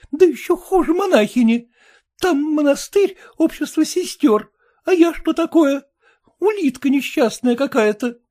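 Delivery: high, loud, tearful wife; young wife. Acted. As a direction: complain like an upset young bride. Wife's tearful complaint.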